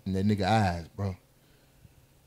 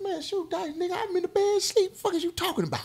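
annoyed retort; 12 semitones higher, 12 dB quieter than usual. Retold or imitated. Annoyed refusal, woken up.

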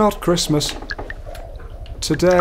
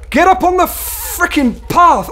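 1 exasperated yell; 12 semitones higher than usual. Real, not acted. Frustrated at game character.

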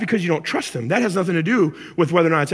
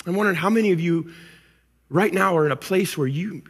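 ordinary talk, nothing marked

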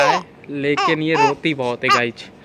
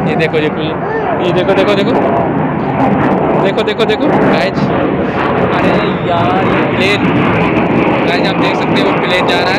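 loud, higher, excited over plane noise; shouting over plane; 4 semitones higher, 12 dB louder than usual. Real, not acted. (हवाई जहाज़ के शोर में ज़ोर से)

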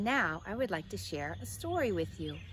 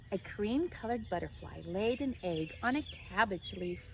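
ordinary delivery

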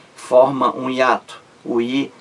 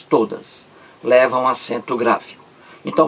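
ordinary talk

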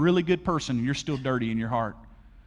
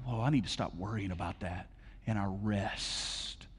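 quiet relieved realization; 8 semitones lower, 10 dB quieter than usual. Retold or imitated. Quoted believer realizing.

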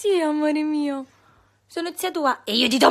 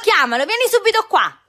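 calling out; 8 semitones higher, 5 dB louder, faster than usual. Real, not acted. Calling someone urgently.

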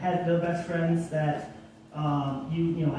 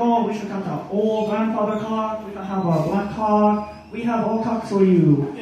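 higher, building-louder imitation of an old shopkeeper; 3 semitones higher, 3 dB louder than usual. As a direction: pitch up as the old clock seller, louder by the end.

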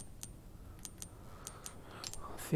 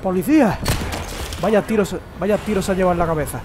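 raging gamer; 2 semitones higher, 3 dB louder than usual. Real, not acted. Fighting enemy, frustrated shouting.

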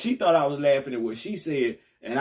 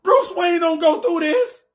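high frustrated rant; 9 semitones higher, 3 dB louder than usual. Real, not acted. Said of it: "continues high rant".

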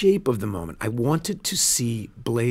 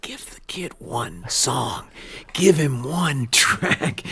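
Blaze's imagined plea; much slower than usual. Retold or imitated. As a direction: stretch each word out slowly, as if voicing someone else. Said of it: "felt like Blaze saying to me".

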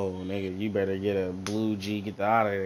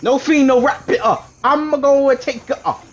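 high, loud hyped shouting; hyped yelling; 10 dB louder, slower than usual. Real, not acted. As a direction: shout it pumped up. Hyped gaming moment.

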